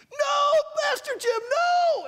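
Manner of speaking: put-on high shouted warning from the children; kids yelling. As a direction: yell like a room of kids. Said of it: "all the kids started yelling".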